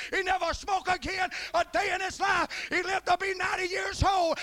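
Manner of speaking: loud, high revival preaching; shouting preacher builds to the climax; fiery preaching. Acted. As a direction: preach it at full force. Preaching at full force.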